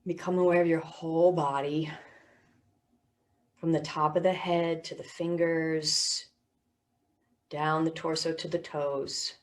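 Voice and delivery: measured body-scan voice with long pauses; guided meditation. Real, not acted. Teacher guiding listeners through body and sounds, guided meditation.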